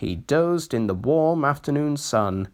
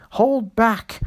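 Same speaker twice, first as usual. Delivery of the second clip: usual speaking voice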